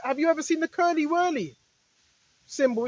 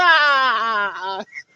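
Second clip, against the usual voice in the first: low, broken laugh close to a sob; real laugh breaking into a cry; half-crying laugh; 6 semitones lower, 4 dB louder than usual. Real, not acted. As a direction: laugh that tips toward crying. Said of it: "But I shouldn't be laughing".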